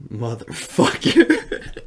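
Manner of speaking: slow, drawn-out curse breaking into laughter; laughing mock curse; retold curse through laughter. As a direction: stretch out the retold swear, laughing. Punchline of story, teller laughing.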